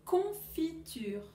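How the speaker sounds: slow, drawn-out Confiture again; slow repeat. Shown as a demonstration. Teacher repeating for pupils, slow and drawn out. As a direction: repeat Confiture slowly for the class. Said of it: "vous allez dire si on entend".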